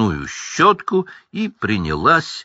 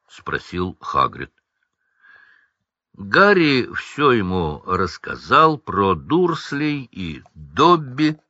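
plain reading voice

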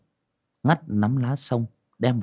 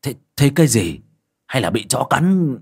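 startled question; 3 semitones higher, 4 dB louder than usual. Acted. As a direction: demand what the shouting is about. Father startled by the shout.

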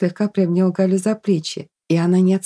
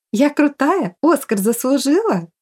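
higher, eager boasting questions; joyful; 7 semitones higher than usual. A narrator's reading. Sofia asks friends to rate her acting, joyful. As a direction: fish happily for praise. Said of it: "радостно спросила она".

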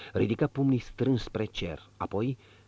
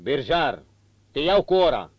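1 curt order; 6 semitones higher, 8 dB louder than usual. A narrator's reading. Marquis hires coachman.

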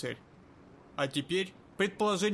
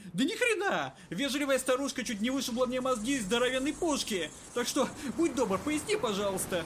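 angry shouting; 7 semitones higher than usual. Acted. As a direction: vent at the assistant. Kirai yells at Malik.

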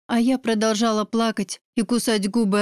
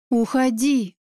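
ordinary delivery

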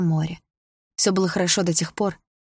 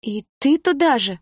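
high, slow, hurt outcry; exclaiming; 8 semitones higher, 4 dB louder than usual. Acted. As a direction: cry it out in hurt protest. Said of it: "воскликнула девушка".

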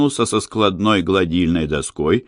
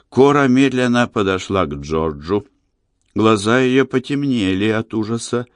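plain reading voice

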